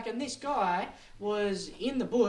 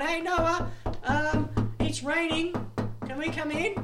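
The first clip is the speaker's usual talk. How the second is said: raised-voice mock plea from outside; acted calling out; 10 semitones higher, 5 dB louder, slower than usual. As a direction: call up to someone to be let in. People outside calling to Noah.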